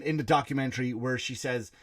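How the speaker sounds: ordinary delivery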